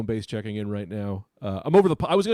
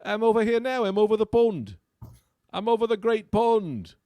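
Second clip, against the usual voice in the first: high-pitched mock English accent; 8 semitones higher, 4 dB louder than usual. Comic claiming to be in England, high English accent. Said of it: "Have you been to England".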